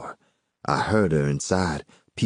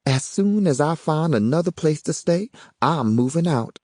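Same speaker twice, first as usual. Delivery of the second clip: higher woman's voice; Yvonne's voice; 10 semitones higher than usual. A narrator's reading. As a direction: read her lines a bit higher.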